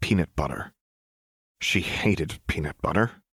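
plain reading voice

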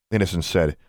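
ordinary delivery

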